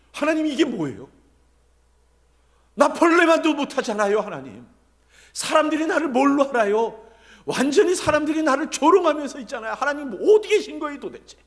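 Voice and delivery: high, loud lament to God; crying out. Acted. David cries to God over people's mockery, loud and high. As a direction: cry out in exasperated protest. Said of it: "이러고 부르짖는 다윗의 고백".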